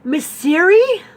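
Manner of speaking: high, slow, loud incredulous echo of her name; stunned disbelief. Retold or imitated. Caller hears her old name echoed back in shock, incredulous. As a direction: say her name like you can't believe it's her.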